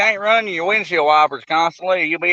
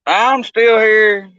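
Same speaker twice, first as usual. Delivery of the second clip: speaking up slowly into radio; loud, drawn out radio talk; 5 semitones higher, 9 dB louder, much slower than usual. Real, not acted. Talking loudly into radio.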